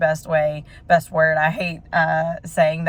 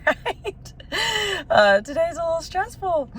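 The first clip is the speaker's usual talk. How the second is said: much higher, strained and close to crying; teary voice; 13 semitones higher than usual. Real, not acted. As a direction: push the words out through tears. Tearful while admitting stress.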